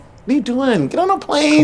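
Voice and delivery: rally the crowd to go now; preacher-style hype cranking up; excited urging. Real, not acted. Urging listeners to invest in themselves.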